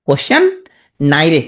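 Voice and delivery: "কোশ্চেন" rising, pause, then "নাই রে"; mnemonic pronunciation. Shown as a demonstration. Teacher drilling the spelling trick, in two separate pieces. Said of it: "তুমি এইভাবে উচ্চারণ করবা".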